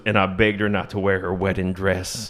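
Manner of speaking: lightly imitated low country balladeer; a hint of dark, poetic country singer. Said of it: "sounds exactly like [name]".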